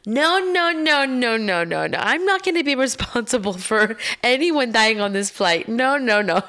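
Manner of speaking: loud, high frantic no no no; a panicked mom refusing the exit row; panicked refusal. Retold or imitated. Mom refusing the exit row seat, loud frantic panic. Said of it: "gets up and she's like".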